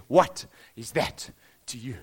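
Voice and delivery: low and slow, a deep calm question. Shown as a demonstration. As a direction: drop the voice low and ask "What is that to you?" slowly. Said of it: "I wonder what tone of voice".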